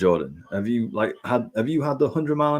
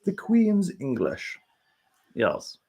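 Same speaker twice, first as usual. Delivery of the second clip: high, slow put-on posh voice; lightly voiced Queen's English, tongue in cheek; mock posh; 8 semitones higher, 5 dB quieter, slower than usual. Joking she's not proper, then a mock posh Queen's English. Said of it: "I'm anything but proper".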